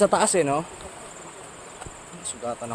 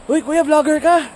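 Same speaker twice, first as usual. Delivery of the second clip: much higher, loud cheerful teasing; excited; 5 dB louder than usual. Real, not acted. Greets a man he meets, teasing.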